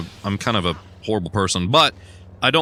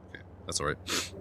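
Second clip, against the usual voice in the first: whispering; 6 semitones lower, 15 dB quieter than usual. Real, not acted. Calming down after a scream.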